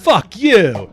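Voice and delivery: loud, high fake outburst; joking shout. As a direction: yell it in jest. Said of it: "No, I'm just kidding".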